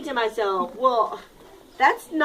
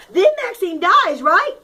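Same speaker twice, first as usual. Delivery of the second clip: higher and louder, eager; eager ant; 6 semitones higher, 5 dB louder than usual. Acted. Teacher as ant eagerly jumping to the ending, louder. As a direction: rush to the ending.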